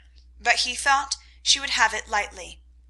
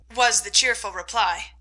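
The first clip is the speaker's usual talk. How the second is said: usual speaking voice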